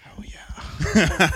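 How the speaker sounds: voiceless whispered agreement; hushed mock-approving aside; whispered relish. Joking agreement about lemons and milk.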